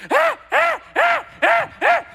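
very high, loud ape shrieks; triumphant monkey. Retold or imitated. Ape shrieking after throwing the rock, very high calls. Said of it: "he immediately goes".

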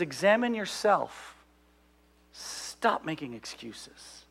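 slow, hushed fatherly advice; retold earnest counsel; quiet admonition. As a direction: let it drop soft and drawn out. Dad's advice repeated slowly and softly.

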